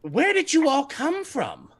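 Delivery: high, slow, prim lady voice. Acted. Party lady questioning the heroes, high and prim. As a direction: ask it primly. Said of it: "and she says".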